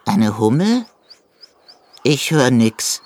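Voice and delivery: leicht verstellte, etwas lautere Eidechsenstimme; ungläubig nachfragen; verwunderte Eidechse. A narrator's reading. Eidechse verneint. (die Eidechse antwortet dem Hasen, verwundert)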